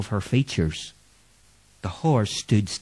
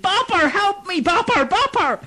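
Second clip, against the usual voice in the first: high, louder desperate cry; shouting for help; 3 dB louder than usual. Acted. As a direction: call out in terror. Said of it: "Clancy shouted".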